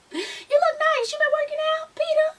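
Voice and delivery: exaggerated high-pitched small talk; imitated small talk. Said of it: "she's trying to make small talk".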